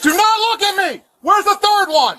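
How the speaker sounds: high, sharp yelled order; shouted command. Real, not acted. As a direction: yell it at them. Officer commanding suspects.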